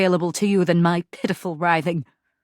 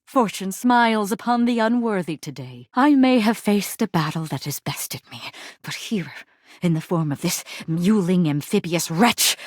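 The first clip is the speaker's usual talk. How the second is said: normal talking voice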